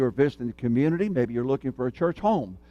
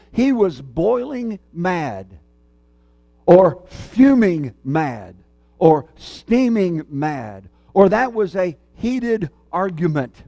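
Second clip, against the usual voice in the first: drawn-out, stressed idioms for anger; preacher drawing out "steaming mad" word by word; slow emphatic phrases; 3 semitones higher, much slower than usual. Shown as a demonstration. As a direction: savour each phrase "boiling mad", "fuming mad" slowly. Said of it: "we speak of these expressions of anger in very graphic ways".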